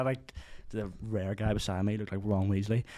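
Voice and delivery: ordinary delivery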